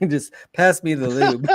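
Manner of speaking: laughing while talking. Real, not acted. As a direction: giggle through the words. Laughing at the lube joke.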